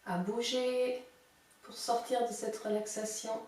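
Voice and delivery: soft, slow guiding voice; calm relaxation cue; guided meditation. Acted. Leads out of relaxation.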